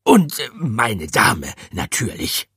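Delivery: slow, courtly correction; bow to the lady as you fix it; gallant hamster. A narrator's reading. Hamster gallantly adding the lady, courtly and slow.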